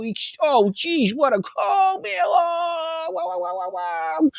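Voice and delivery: whiny mock sob climbing to a high wail; mock crying. Host mocking Elon crying about his falling stock, whining into a high wail. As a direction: ham up a man crying over his stock. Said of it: "he's crying about".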